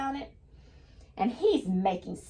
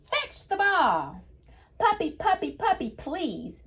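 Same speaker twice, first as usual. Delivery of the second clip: storybook reading voice; 8 semitones higher than usual. Acted. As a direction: coax the puppy like a child. Teacher reads book's fetch line.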